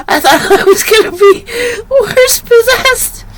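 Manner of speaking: laughing squeal, words stretched. Real, not acted. Vlogger recalling the frog fright, laughing high. Laughing about going crazy.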